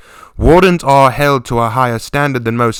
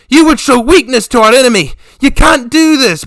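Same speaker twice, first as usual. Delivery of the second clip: high, loud furious pleading; angry protest; 8 dB louder than usual. Acted. Yilda protests to her father, loud and furious. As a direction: rage against the plan.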